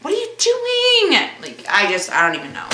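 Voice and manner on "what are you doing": incredulous. Retold or imitated. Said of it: "Or I'm like".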